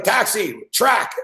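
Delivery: light imitation of hailing a cab; mock taxi hail. Bender hailing a taxi to the track, mock calling out.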